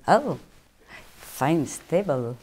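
put-on light, jokey French accent; half-hearted French accent for a laugh; mock French accent. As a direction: toss it off in a vague French accent. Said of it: "I don't know what accent that was, but we'll go with French".

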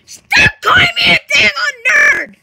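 high, extremely loud yell; hammed-up shrieking outrage; furious scream. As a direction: explode at the insult, screaming. Explodes at being called nerd.

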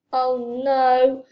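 loud, drawn-out cry of dismay; long, loud yell. A narrator's reading. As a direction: yell it out in alarm. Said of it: "Franklin yelled".

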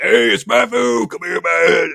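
high, slow friendly call-over; imitating Alexander James. Mimicking Alexander James calling Matthew over, high and slow. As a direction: mimic a buddy waving you over. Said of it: "That's how he talks".

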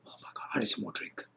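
rapid breathless whisper aside; fast whisper. Real, not acted. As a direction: whisper it quickly to yourself. Aside while drinking.

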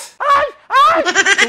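laughing. Real, not acted. Host laughing at wrong answer.

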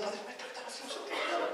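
hushed, voiceless mumbled prayer; priest praying. Acted. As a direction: pray under your breath, imitating a priest. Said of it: "tenía un cura rezando". (rezo de cura susurrado)

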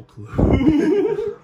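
high squealing laugh through the words; cracking up too hard to talk. Real, not acted. Laughing at the weird noise, answering his friend.

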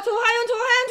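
rising tense calling; excited. Real, not acted. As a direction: build the suspense. Wheel slowing on names.

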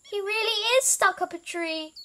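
high, loud announcement; exclaiming elephant. A narrator's reading. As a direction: call out the surprising discovery.

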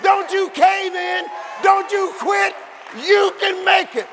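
ordinary delivery

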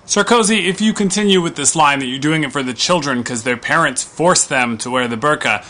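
ordinary talk, nothing marked

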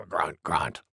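low, soft boar grunts; grunting wild boar. A narrator's reading. Wild Boar after the sneeze, low soft grunts. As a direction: settle into low little grunts. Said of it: "said Wild Boar".

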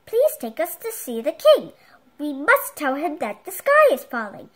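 pleading. Acted. Chicken Little pleading with the hounds, loud and urgent. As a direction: beg the hounds earnestly. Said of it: "Chicken Little pleaded".

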